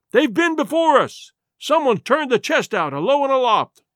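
high, urgent calling down; cried report. A narrator's reading. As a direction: call the bad news down from the window. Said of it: "he cried".

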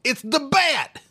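henchman's yell. Acted. Game henchman warning of Batman, high yell. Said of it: "henchman in an Arkham game who yells".

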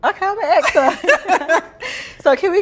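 laughing through the words. Real, not acted. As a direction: laugh as you say it. Laughing at the answer.

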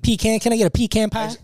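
pecan said as "PEE-can"; pecan starting "pee", ending like "can"; southern pecan. Shown as a demonstration. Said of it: "I like to go southern".

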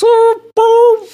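very high, loud mock turkey noise; sudden high-pitched bird impression. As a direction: squawk it out like a turkey. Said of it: "That was my impression of a fucking turkey".

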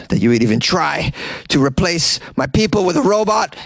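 ordinary delivery

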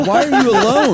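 high, loud pitying question; mock concern. Fan asking the singer about being alone, mock concern. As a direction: imitate an aghast fan, close to laughing. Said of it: "Genuine concern".

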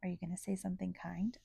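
soft aside whisper. Real, not acted. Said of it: "unvoiced whisper".

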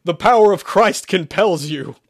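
loud, high, forceful proclamation; shouted exorcism. A narrator's reading. Exorcist raising the cross, shouted command. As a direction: cry it out with full force. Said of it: "Sherman shouted".

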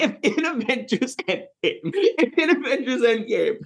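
high, giggly, barely getting it out; laughing while talking. Real, not acted. Host pitching an absurd crossover to co-hosts, laughing through it. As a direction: crack up mid-sentence, pitch climbing. Cracking up at own movie bit.

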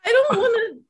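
high, loud tearful protest; crying. Real, not acted. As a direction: whine it out through real tears. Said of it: "I'm crying because of it".

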